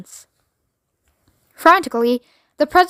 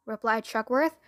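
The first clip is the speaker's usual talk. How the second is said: ordinary delivery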